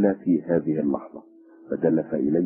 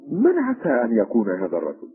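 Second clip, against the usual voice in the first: higher-pitched puzzled question; astonished; 6 semitones higher than usual. A narrator's reading. Officer staring at the unknown man, mildly astonished. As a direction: ask in mild amazement, voice lifted. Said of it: "وحدق ضابط في الرجل في دهشة".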